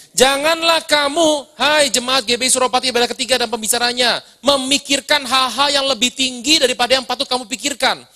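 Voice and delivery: preacher reading scripture aloud to the church. Acted. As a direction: proclaim the verse to the congregation. Reads Romans 12:3 aloud.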